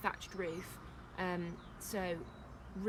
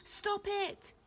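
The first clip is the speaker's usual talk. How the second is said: very high-pitched cry; yelled; 13 semitones higher than usual. A narrator's reading. Chaya held by captors, high yell. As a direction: yell in protest. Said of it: "yelled Chaya".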